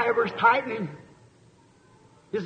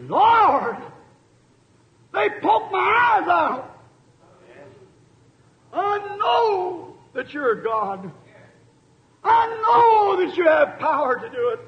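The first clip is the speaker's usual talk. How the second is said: crying out; 8 semitones higher than usual. Acted. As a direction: shout it up to heaven in anguish. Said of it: "Then Samson cried out".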